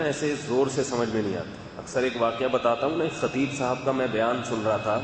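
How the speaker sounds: usual speaking voice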